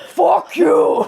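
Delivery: put-on laughing, shouted old man curse. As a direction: yell it like a cranky old man. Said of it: "in an old man voice".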